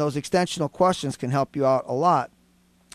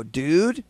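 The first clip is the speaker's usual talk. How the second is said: DUDE as a rising question. Shown as a demonstration. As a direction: ask "Dude?" with the pitch sliding up. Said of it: "one word with a different tone of voice".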